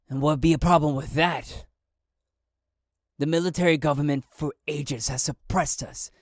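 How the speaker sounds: loud, menacing challenge; intimidating. Acted. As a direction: lean in and threaten him. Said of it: "a little bit of intimidation in that voice".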